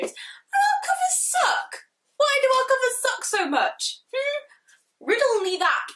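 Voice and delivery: very high, slow, wailing complaint; outraged wail. Real, not acted. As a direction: wail in mock despair. Ranting about UK covers.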